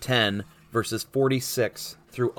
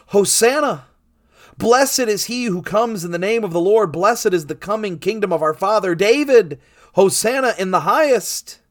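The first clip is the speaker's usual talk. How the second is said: loud, high acclamation; crowd crying out; 8 semitones higher, 6 dB louder than usual. A narrator's reading. Crowd going ahead and following Jesus, crying out praise. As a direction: proclaim it like a cheering procession. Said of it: "And they cried out".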